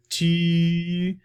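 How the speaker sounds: high, loud, long "Tu"; French U. Shown as a demonstration. Teacher demonstrates the French U, long high "Tu". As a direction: sustain "Tu" with a rounded-lip ee. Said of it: "French U".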